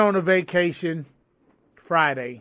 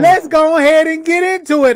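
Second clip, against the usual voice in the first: high and loud with leftover laughter; laughing; 8 semitones higher, 7 dB louder than usual. Real, not acted. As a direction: push on while still amused. Laughing, starts the topic.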